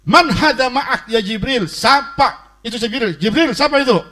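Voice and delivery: high, loud snapped questions; membentak. Retold or imitated. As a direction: imitate a stern prophet yelling at an angel. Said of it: "Jibril dibentak-bentak". (membentak dengan nada tinggi)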